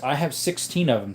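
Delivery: normal talking voice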